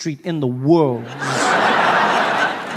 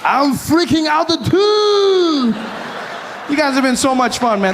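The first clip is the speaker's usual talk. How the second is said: excited sign-off; 9 semitones higher than usual. Real, not acted.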